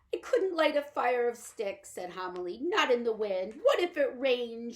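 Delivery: high, loud, pleading little woman; Homily pleading with Pod for the dollhouse; anxious little mother. Acted. Homily fretting.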